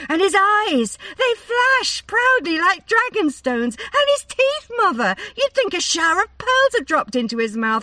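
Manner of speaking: ecstatic swoon. A narrator's reading. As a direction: build to a breathless squeal of delight. Daughter swooning, climbing.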